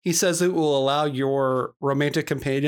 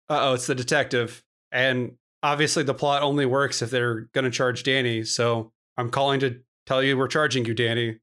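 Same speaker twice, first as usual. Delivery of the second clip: ordinary talk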